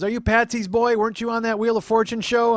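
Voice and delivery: high, loud excited neighbor lady; neighbor woman. Retold or imitated. Neighbor asking if he was on television, high and loud. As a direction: quiz him in a high, hollering woman's voice. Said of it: "She goes".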